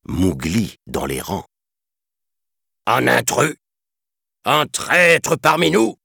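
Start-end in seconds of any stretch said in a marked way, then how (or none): 2.9-6.0 loud, high, outraged commander; furious outcry. A narrator's reading. Elephant chief finding the boy in the ranks, furious. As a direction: draw out the accusation in indignation. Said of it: "quelle fut la fureur d'Hati".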